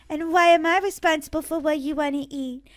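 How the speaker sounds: usual speaking voice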